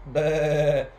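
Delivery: loud, wavering baa; sheep bleating. A narrator's reading. Storyteller voicing the new sheep, bleating. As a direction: imitate a sheep with one bleat. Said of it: "and the sheep going baa".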